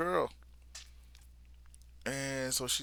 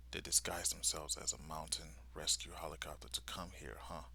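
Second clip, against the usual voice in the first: whispered, much softer musing; inner thought; 5 semitones lower, 10 dB quieter, faster than usual. Retold or imitated. Soldier realising their disguise, whispered thought. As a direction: whisper it to yourself. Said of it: "he's thinking to himself".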